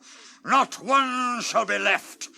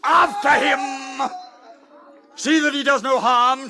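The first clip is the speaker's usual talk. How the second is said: shouted order; 5 semitones higher, 6 dB louder than usual. Acted. King ordering guards after the traitor, shout. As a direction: call out to the guards.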